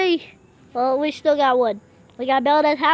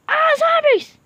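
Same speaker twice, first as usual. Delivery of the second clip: much higher startled cry; startled yell; 10 semitones higher, 3 dB louder than usual. Real, not acted. Spotting zombies in the dark, startled yell. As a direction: yelp at the zombies.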